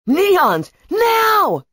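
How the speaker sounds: high shouted signal; urgent command. Acted. Zeke signals the Neons as Brachus fires, urgent shout. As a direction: call your team to action. Leader signals team under fire.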